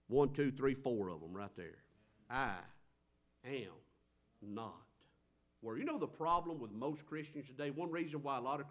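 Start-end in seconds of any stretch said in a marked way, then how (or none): 2.3-5.8 each word set apart with long pauses; slow spaced words. Shown as a demonstration. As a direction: space out I am not worthy slowly. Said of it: "words that I think ought to be in our vocabulary".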